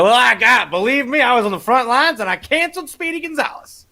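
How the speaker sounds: high, put-on self-important voice; hammed-up heroic bragging, pitched well up; boastful husband. Husband bragging to his wife about cancelling, mock-heroic voice. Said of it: "then his wife's like".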